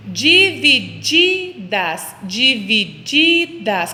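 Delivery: divididas very slowly, the second di held long; syllable-by-syllable stress. Shown as a demonstration. Teacher quizzes children on divididas, each syllable drawn out. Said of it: "Qual que é mais forte".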